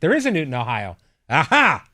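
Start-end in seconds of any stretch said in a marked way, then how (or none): 1.3-1.9 gleeful gotcha cry; triumphant aha. Real, not acted. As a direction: crow it like a victory.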